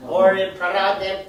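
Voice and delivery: usual speaking voice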